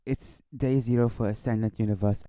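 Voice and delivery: normal talking voice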